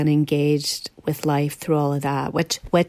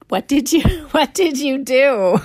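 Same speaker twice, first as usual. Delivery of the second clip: asking through a real laugh; laughing question; 9 semitones higher than usual. Real, not acted. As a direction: giggle your way through the question. Laughing while asking her question.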